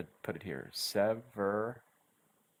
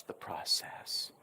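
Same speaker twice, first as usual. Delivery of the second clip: whispered advice; 7 dB quieter, slower than usual. Real, not acted. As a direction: breathe it out as a secret tip. Teacher advising calm.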